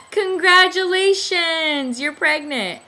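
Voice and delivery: high, loud and slow, drawing out the congratulations; beaming announcement. Retold or imitated. Nurse walks in smiling to tell the patient, high beaming congratulations. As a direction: sing it out like delivering the best news.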